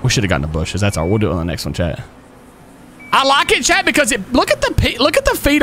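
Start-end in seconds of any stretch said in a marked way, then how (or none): 3.1-5.6 excited. Real, not acted. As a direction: pitch up, thrilled by it. Excited about new feature.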